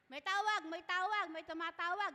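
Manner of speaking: loud, high call of "may tawag"; husband shouting from the other room that someone is calling; Father George calling. Retold or imitated. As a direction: call out through the house that the phone is ringing. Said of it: "Ganun si Father George eh".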